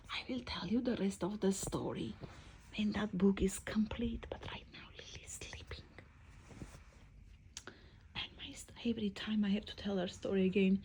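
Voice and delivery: dropping into a soft whisper; very soft, hushed aside. Real, not acted. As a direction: whisper so the sleeper stays asleep. Hushed because Lily is sleeping.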